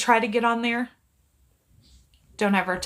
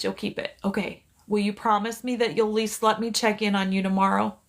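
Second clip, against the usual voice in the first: ordinary talk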